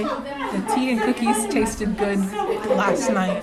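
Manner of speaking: slow, attempted British accent. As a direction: try a careful British accent, drawn out. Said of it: "let's start simple with British accents".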